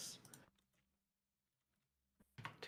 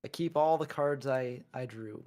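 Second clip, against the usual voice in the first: ordinary delivery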